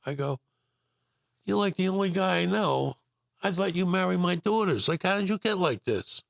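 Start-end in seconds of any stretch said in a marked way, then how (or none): 1.4-6.2 higher, eager retold question; amazed question. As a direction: gush at him like you can't believe he exists. Speaker retells his amazed question.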